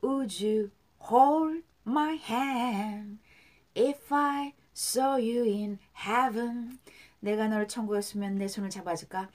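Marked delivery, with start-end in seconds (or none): 0.0-6.8 very slow, stretched, sustained words; repeat-after-me model. Shown as a demonstration. Teacher leads repetition of the lyrics, slow and drawn out. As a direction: hold each word long for learners to echo. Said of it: "자, 따라할게요".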